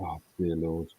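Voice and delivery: ordinary talk, nothing marked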